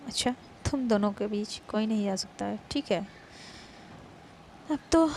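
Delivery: loud, taunting rival's vow; loud jealous dare; defiant challenge. Acted. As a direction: throw the challenge back loudly.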